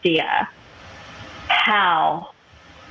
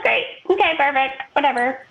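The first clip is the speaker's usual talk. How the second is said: sarcastic acceptance; 6 semitones higher than usual. Retold or imitated. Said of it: "I'm like".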